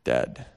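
low, dropped word dead; low flat dead. Shown as a demonstration. Preacher describing the dead mouse, low and final. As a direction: let dead fall flat. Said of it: "dead mouse".

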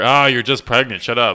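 loud, exasperated brush-off in play; mock dismissive. Playing the friends dismissing a woman in labor, loud mock impatience. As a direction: wave off a complaint with hammed-up impatience.

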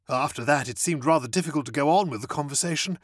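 ordinary delivery, nothing marked

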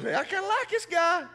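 high, loud, lightly voiced warden; pleased jailer. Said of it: "the warden goes".

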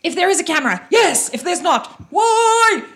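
loud, high, playful exclaiming; hammed-up shouted reaction; acted outburst. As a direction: build to a high shout on the last word. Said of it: "I'm like".

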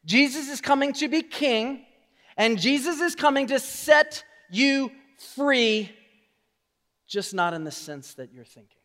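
normal talking voice

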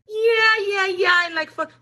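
high, loud mock agreement; imitated eager man. Man replies to her blunt question, hammed-up high eagerness. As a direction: answer too quickly in a squeaky imitation. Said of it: "And I was like, "You like fucking me?".

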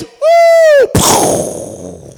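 high sustained whoop into a burst of air; collapsing-plan noise. Retold or imitated. Preacher mimics a plan failing, high whoop. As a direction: imitate something falling apart with a high "woo". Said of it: "the plan goes".

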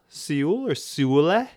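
Seoul as "see-ool", then Seoule as "soo-leh"; completely wrong pronunciation. Pretend. Inventing wrong names, Seoul as "see-ool", Seoule as "soo-leh". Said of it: "pronounce it completely wrong".

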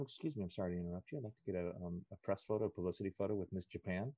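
soft, fast apologetic request; polite interruption. Retold or imitated. Guest asking celebrities for a photo, soft polite rush. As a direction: slip in softly and apologize quickly.

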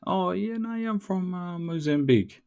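high, chirpy imitation of a polite chat; lightly voiced small talk. Host imitating strangers chatting about Mozambique, mock chirpy. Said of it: "then I get straight to the comments".

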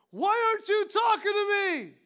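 put-on high, loud, frantic cry of a scared boy; a child's panicked shout of betrayal; desperate yell. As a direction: scream it out, lost and hurt. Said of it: "He yells at me like that, in that tone of voice".

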